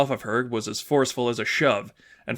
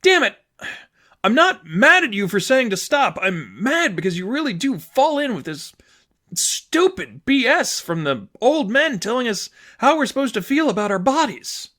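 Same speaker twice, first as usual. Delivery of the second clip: frustrated anger; 10 semitones higher than usual. Acted. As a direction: curse then rail against them. Sadie angry at the rules.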